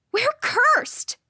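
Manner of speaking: very high, loud yell; anguished high-pitched shout; desperate outcry. A narrator's reading. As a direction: blurt out the awful truth. Rival blurts out the secret.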